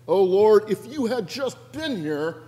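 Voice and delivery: high, pleading accusation to the Lord; anguished reproach. Acted. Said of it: "anguish, the anger".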